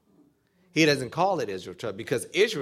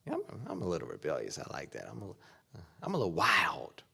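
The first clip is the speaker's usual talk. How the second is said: a hint of smug, offhand boasting, much softer; mock bragging; 5 semitones lower, 8 dB quieter than usual. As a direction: shrug it off like a proud little rebel, lightly voiced. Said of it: "We say that".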